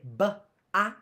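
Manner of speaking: "ba-at" as buh, ah, t with gaps; isolated sounds. Shown as a demonstration. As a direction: pull "ba-at" apart sound by sound. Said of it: "those are just sounds I'm making".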